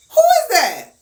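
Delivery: high, loud startled question; mock supervisor. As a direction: demand through the door. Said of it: "She go".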